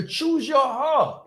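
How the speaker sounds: high, slow, stretched-out challenge; rallying challenge. Real, not acted. Coach challenging listeners to choose, rallying call.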